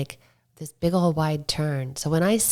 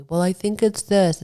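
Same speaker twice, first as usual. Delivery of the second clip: imitating an editor; 5 semitones higher, 6 dB louder than usual. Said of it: "tell me".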